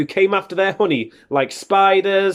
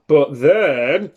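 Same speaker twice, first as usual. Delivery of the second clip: ordinary delivery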